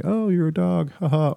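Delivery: high-pitched mock-amused teasing; teasing locals. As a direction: imitate locals joking with a token haha. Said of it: "they say".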